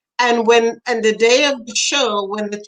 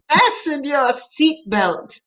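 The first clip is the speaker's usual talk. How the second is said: slow, raised showman's announcement; hammy child host; 5 semitones higher, slower than usual. Retold or imitated. Small boy opening his own birthday party, hammy announcer. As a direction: stretch it out like a little emcee. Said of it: "He was always a ham".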